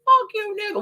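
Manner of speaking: high mock snap at the rude worker; imitating a hostile comeback. Imagining facing the rude cashier, high mock hostility. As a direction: throw it out like picking a fight. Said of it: "ready to be hostile".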